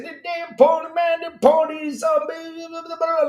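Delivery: high, worked-up imitation of an angry friend; agitated buddy. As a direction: ham up a friend ranting at nobody. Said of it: "He gets real agitated, real mad".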